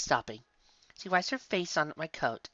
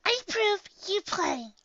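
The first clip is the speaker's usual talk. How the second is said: put-on high childlike character voice; young pony's voice; slower than usual. A narrator's reading. Young pony urging her friend to play, childlike squeak. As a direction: speak up in a squeaky kid voice. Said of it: "I said".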